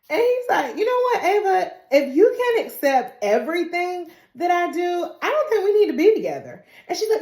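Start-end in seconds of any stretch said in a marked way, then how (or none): 0.8-6.6 mock huffy breakup. Mayor breaking up, acted.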